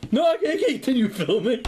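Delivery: high put-on producer voice; lightly voiced fussy producer; mock producer refusing to film; imitating a producer. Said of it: "I can see the producers going".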